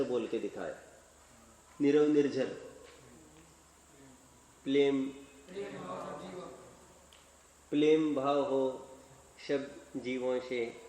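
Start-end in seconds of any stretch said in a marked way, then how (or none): 1.8-10.8 put-on a light toddler lisp, r turning to l in Prem; mildly imitated lisping little boy reciting; lisping child. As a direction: recite like a small child who cannot say r, Prem as plem. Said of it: "तोतले, तोतले आवाज में".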